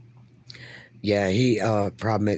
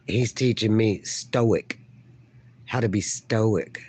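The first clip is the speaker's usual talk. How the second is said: ordinary delivery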